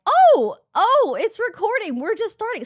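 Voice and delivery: high, loud burst of surprise; startled realisation. Retold or imitated. Host retelling how she noticed it's already recording, startled. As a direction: caught off guard, voice jumping up.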